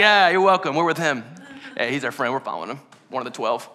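low, soft and smug, grinning through it; a proud disciple lapping up the credit; cocky, smiling. Retold or imitated. Disciples handing out bread to the crowd, smugly cocky. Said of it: "walk around smiling and cocky".

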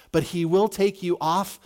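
ordinary talk

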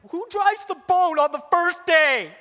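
loud, high comic protest; outraged disbelief. Retold or imitated. Protests being made to drive.

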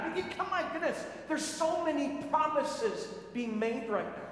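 ordinary talk, nothing marked

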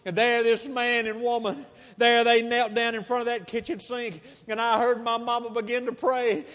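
normal talking voice

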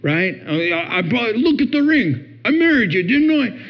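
hammed-up husband losing patience; frustrated husband. Imitating a fed-up husband scolding his insecure wife, rising exasperation. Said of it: "So instead of being frustrated".

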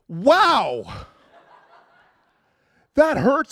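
loud high pained cry, laughing through it; retold ouch, high and loud; pained yelp. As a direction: yelp as the wax strip rips off. Retold waxing pain cry.

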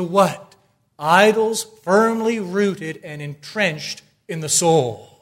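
loud, high, hammering pulpit voice; full-force preaching. Acted. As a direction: preach it out, pounding each question.

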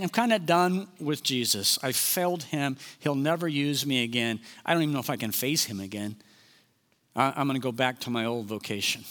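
low, softened voice of defeat; dejected resignation. Acted. Peter feeling he failed Jesus, quietly giving up.

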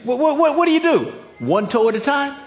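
high, loud and pushing the crowd for an answer; insistent loud questions. Real, not acted. Preacher demanding an answer from his congregation, loud and high.

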